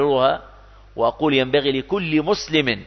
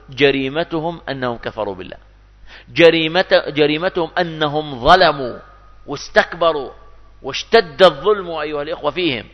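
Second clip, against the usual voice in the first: ordinary delivery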